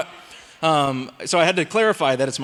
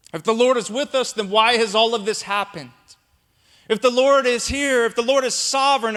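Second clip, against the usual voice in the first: raised, pleading sermon delivery; preaching; 6 semitones higher than usual. Acted. Pastor voicing Gideon's complaint to God, preaching. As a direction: press each why hard.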